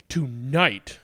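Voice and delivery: plain reading voice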